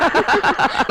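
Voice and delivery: high bright burst of laughter; a proud father's happy laugh; delighted laugh. Real, not acted. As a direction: laugh with pride at her. Father delighted by daughter's answer.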